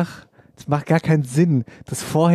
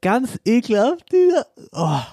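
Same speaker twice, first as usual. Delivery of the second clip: high, drawn-out disgust; exaggerated disgust; 7 semitones higher than usual. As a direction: ham up the disgust, pitched up. Mock disgust over hungry friend.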